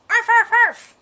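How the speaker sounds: high, loud yappy barks; yapping little poodle; dog barking. Acted. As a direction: bark like a small poodle. Puppy barking at snake.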